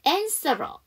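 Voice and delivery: "answerer" with the R carried into the next vowel; linked R. Shown as a demonstration. Teacher demonstrates dropped H, linked "answerer". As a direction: drop the H and roll "answerer" together as one word. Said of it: "알 발음을 넘겨서".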